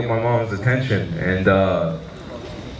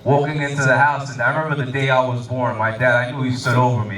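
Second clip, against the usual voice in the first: ordinary talk, nothing marked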